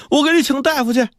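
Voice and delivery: put-on high-pitched crying child; acted tearful urgency. A narrator's reading. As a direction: cry it out as a boy, urgently. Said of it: "他一边哭".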